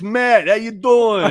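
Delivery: loud, high Philly dude voice, slow; Philly accent. Retold or imitated. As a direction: doe een Philly-kerel na. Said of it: "Some dude in Philly that talks like this".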